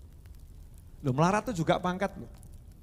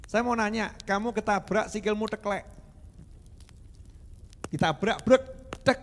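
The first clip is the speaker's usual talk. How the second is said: normal talking voice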